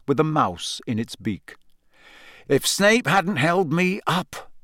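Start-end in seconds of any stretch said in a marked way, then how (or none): 2.5-4.5 slow, higher, resentful; slow bitter complaint. A narrator's reading. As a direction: dwell bitterly on the grievance. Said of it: "said Harry bitterly".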